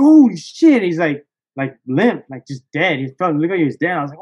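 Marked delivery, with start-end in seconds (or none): 0.0-0.8 loud, high burst of disbelief; shocked exclamation. Retold or imitated. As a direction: react as if the fish just appeared. Seeing the fish on the line.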